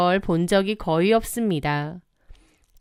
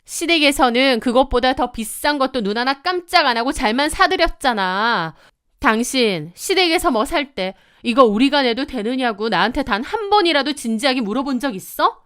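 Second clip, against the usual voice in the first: escalating anger; 4 semitones higher than usual. Acted. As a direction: hammer each occasion harder. Wife's escalating quarrel with husband.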